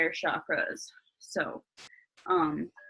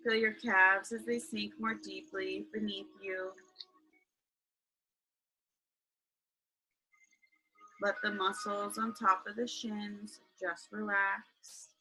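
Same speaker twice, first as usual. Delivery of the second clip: guided meditation; 12 dB quieter than usual. Acted. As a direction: ease them into calm, right in your ear.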